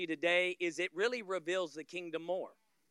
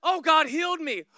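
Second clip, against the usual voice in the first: raised, amazed exclamation; mock surprise; 7 semitones higher, 8 dB louder than usual. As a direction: play the stunned believer. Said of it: "we're shocked".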